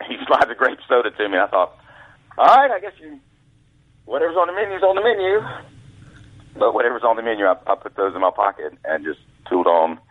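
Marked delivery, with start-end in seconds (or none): 2.4-5.4 high-pitched giving in; mock resignation. New caddy giving up at the food window, high mock resignation. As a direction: concede in a high, put-on voice. Customer gives up on ordering.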